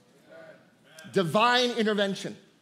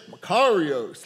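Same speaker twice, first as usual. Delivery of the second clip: put-on Makarios in a lightly drawled cowboy accent, pitched lower; mock the congregation's Western twang on Makarios; 7 semitones lower, slower than usual. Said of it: "like a cowboy".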